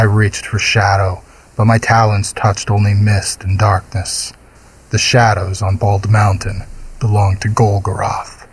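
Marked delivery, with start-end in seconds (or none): none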